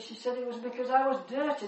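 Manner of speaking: ordinary talk, nothing marked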